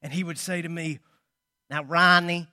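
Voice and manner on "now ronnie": louder, lightly imitated Boston accent; imperfect Boston accent, pushed out loud; attempted Boston accent. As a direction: try a rough Boston accent on the name. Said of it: "I can't do the Boston accent".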